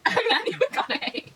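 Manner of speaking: mock grand villain. Mock grand villain self-introduction.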